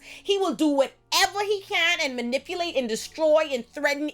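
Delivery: high-pitched, insistent, worked-up rant; heated rant. Real, not acted. Viewer ranting that Lestat can't be trusted, heated. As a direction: argue it hard, voice pushed way up.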